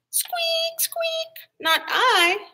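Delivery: very high squeaky rat. A narrator's reading. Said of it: "And the rat said".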